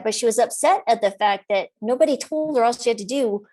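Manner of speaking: usual speaking voice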